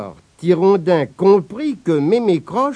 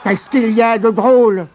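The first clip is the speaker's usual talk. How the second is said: bougonner; 5 semitones higher, 4 dB louder than usual. Acted. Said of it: "bougonna Mémé Croche".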